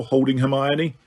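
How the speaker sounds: plain reading voice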